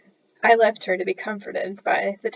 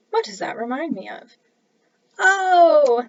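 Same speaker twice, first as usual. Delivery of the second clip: ordinary delivery, nothing marked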